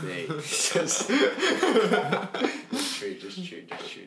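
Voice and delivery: high laughing words. Real, not acted. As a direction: say it while laughing hard. Laughing hard at friend's joke.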